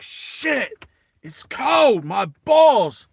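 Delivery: high, loud whine about the cold; hammed-up freezing grumble, pitch shooting up; loud complaining. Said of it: "he's, like, complaining".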